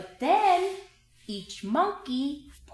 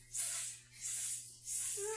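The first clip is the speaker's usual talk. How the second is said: whispered monkey hisses; monkey hissing; 10 semitones higher, 16 dB quieter, slower than usual. A narrator's reading. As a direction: hiss like cheeky monkeys. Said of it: "and said".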